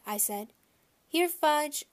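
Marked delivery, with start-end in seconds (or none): 1.1-1.9 loud, higher call. A narrator's reading. Peter searching the aisles for his brother, loud higher call. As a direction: call down the aisle like calling a dog. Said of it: "I called".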